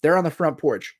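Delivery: ordinary talk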